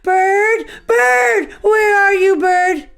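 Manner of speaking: very high shouted calls; frantic squirrel hunting for Bird; panicked shout. Acted.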